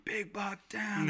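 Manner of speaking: high, drawn-out mock holler; retold scream. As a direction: holler it out long and high, re-enacting the triumph.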